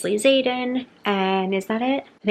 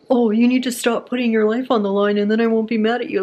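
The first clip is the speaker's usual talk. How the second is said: loud mocking put-on boyfriend voice; mock his scolding in a put-on voice; mimicking boyfriend; 3 semitones higher, 4 dB louder, faster than usual. Friends mimicking the couple's fight, loud mocking scold. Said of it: "they're, like, mimic".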